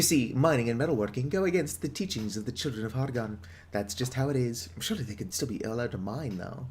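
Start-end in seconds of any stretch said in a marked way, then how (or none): none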